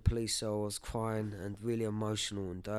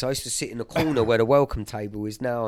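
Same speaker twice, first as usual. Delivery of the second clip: excited; 3 semitones higher, 12 dB louder than usual. Real, not acted. Joyful church memory, rising excitement.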